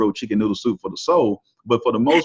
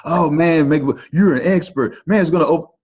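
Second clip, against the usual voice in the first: raised pitch, mocking imitation of a doubter; higher, slightly louder mock exclamation; mimicked self-help sceptic; 6 semitones higher, 3 dB louder than usual. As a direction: parrot a sceptic scoffing at self-help hype.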